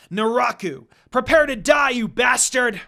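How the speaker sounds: loud furious battle cry; yelled death threat; angry yell. A narrator's reading. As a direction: shout it at the enemy with full force. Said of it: "Inuyasha yelled".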